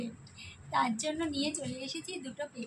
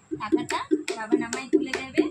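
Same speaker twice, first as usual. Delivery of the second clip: shrieking jackpot winner; 10 dB louder than usual. Real, not acted. Demanding the prize money.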